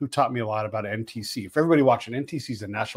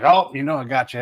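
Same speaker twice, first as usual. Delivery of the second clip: jovial teasing; 3 semitones higher, 8 dB louder than usual. Retold or imitated. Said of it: "it was kinda jovial".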